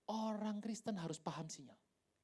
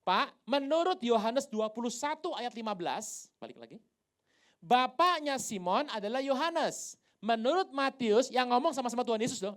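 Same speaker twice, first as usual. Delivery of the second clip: challenging question; 2 semitones higher, 9 dB louder than usual. Retold or imitated. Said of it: "Anda pernah tanya sama saya?".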